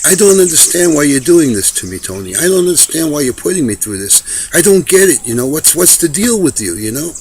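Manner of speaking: loud, high pleading anger; betrayed fury. Retold or imitated. Betrayed friend confronting Tony, loud and high. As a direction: demand answers from a man who betrayed him. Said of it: "he was in a fury, he felt betrayed".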